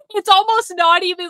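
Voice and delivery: ordinary delivery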